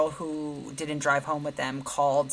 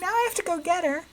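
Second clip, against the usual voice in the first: much higher, fast, exasperated; exasperated daughter; 3 dB louder, faster than usual. Retold or imitated. Daughter exasperated on the phone as the girl escapes the car. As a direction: rattle it off in disbelief as she bolts.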